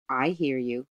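plain reading voice

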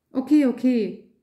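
hoch und laut, mit spöttischem Unterton; sarkastisch. A narrator's reading. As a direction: genervt abwinkend. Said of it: "mit einem sarkastischen Unterton". (sarkastisches, lautes Okay, okay)

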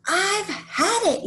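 very high, drawn-out outburst; storybook tantrum cry at moderate volume; fed-up yell. Acted. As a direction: stretch it out in mock exasperation. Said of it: "yelled Liz".

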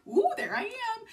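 high, pleased mock gasp at her own reflection; vain delight. Vlogger mocking her vanity at the mirror, high pleased coo. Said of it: "you're just like".

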